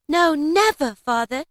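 put-on children promising their father; crying out again. A narrator's reading. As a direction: insist eagerly to father. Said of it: "they all cried again".

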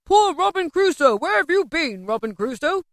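high mimicked cry, dropping toward the end; high, sing-out parrot voice; parrot's call. A narrator's reading. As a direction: mimic a parrot calling its master.

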